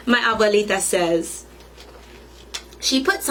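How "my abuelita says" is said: ordinary delivery, nothing marked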